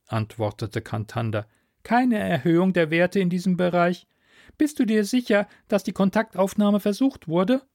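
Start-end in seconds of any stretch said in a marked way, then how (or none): 1.8-7.6 high-pitched mentor voice, measured questioning; questioning Philomen mentor. Acted. Mentor reporting scan results by radio, high-pitched questioning. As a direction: report and query in a raised pitch.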